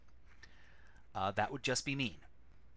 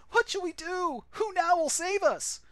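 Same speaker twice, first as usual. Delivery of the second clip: frightened villagers; 6 dB louder than usual. Acted. Villagers beg the master.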